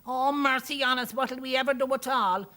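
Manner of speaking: high, loud Irish lament; panicked townsperson crying out; wailing. A narrator's reading. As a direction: wail in alarm with an Irish lilt. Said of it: "somebody wailed".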